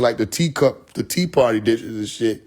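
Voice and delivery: ordinary talk, nothing marked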